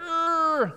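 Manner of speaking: high-pitched drawn-out "uh" of grumpiness; mock grumpy grumble; grumpy groan. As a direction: groan like someone tired and cross. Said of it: "got a little bit ... grumpy".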